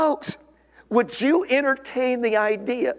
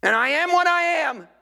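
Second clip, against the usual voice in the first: defiant self-defense; 3 semitones higher than usual. Acted. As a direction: bristle like a man defending his temper. Acted defensive churchgoer justifying temper.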